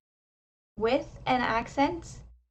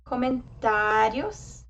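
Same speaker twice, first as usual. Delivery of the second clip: slow teaching model of comentarios, weight on the A; emphasized A; 4 dB louder, slower than usual. Shown as a demonstration. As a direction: stretch the A in comentarios, then let the ending soften. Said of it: "the A is emphasized".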